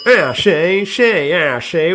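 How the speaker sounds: mock a clumsy attempt at a sing-song accent; worst impression ever. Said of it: "then he does the worst impression ever".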